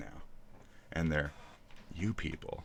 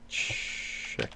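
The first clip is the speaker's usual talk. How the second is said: sounding it out; 7 dB quieter than usual. Real, not acted. Tries out the unfamiliar word.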